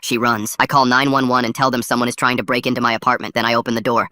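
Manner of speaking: plain reading voice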